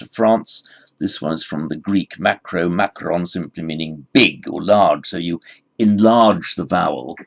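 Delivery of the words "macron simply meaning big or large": normal talking voice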